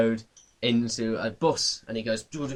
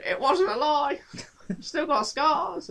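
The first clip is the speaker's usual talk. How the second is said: squeaky voice; slower than usual. Acted. Portal alarm voice.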